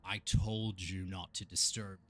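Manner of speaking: slow, loud booming overlord; booming arrogant alien overlord. Acted. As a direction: boom it out slowly, contemptuous. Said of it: "this big booming voice".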